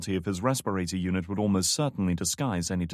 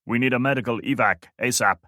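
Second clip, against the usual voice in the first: louder, borrowed guard's voice; imitating Lopez; 3 semitones higher, 5 dB louder than usual. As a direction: pose as the guard on the radio. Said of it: "imitating the man's accent".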